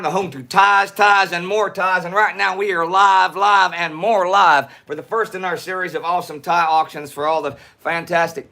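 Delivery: hammed-up Texas twang for a live intro; put-on Texas drawl. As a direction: pitch it like a Texan auction host. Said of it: "not gonna talk like this".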